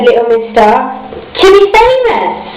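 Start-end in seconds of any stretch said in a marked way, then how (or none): 1.4-2.4 high and bright with pride; proud. A narrator's reading. Miss Star tells the hostess her dream, high and proud. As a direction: announce it like a dream come true.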